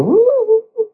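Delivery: high, loud ooh of wonder; high loud cries of wonder from the healed woman; mock awed ooh. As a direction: imitate her overwhelmed ooh. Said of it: "And I heard her go".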